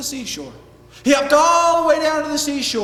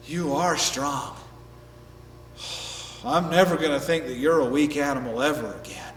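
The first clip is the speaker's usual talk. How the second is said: big animal in quiet respect; humbled whale; 10 semitones lower, 5 dB quieter than usual. Acted. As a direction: concede gravely and deeply. Beaten whale concedes to rabbit.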